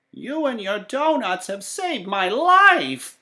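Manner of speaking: put-on high, slow old woman voice; grateful old woman. A narrator's reading. The old woman thanking Sam, high slow old voice. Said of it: "she said".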